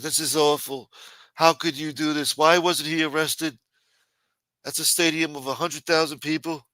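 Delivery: mildly indignant, imitating someone appalled. Retells an indignant reaction to the video.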